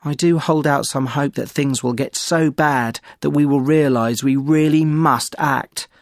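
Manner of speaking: ordinary talk, nothing marked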